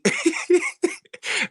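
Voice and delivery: high chuckle; a quick high laugh at the memory; amused laugh. Real, not acted. Telling the host about the governor, laughing. Laughs before naming Ducey.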